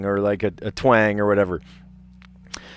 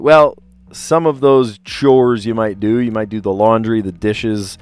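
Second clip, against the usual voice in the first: ordinary talk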